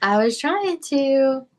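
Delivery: usual speaking voice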